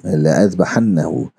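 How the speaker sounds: लअज़बहन्नहु read slowly in Arabic recitation; Arabic tajweed reading of लअज़बहन्नहु; Quranic recitation. Shown as a demonstration. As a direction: recite the word slowly, the la kept short. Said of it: "आपके पास सारी details आ जाएंगी".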